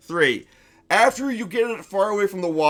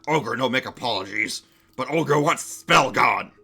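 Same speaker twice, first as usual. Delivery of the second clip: stubborn ogre; 3 semitones lower than usual. Acted. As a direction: refuse like a proud, lumbering ogre. Ogre refuses, wants spell gone.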